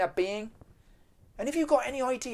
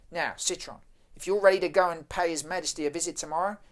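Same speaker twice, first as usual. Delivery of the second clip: ordinary delivery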